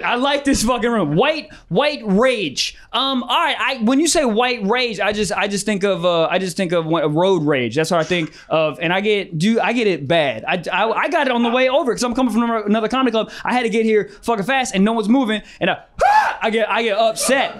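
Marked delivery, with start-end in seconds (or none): 1.2-2.8 slow repeat. Shown as a demonstration. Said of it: "repeating suggestion slowly". 15.6-16.4 sharp high scream of road rage; sudden yell. Retold or imitated.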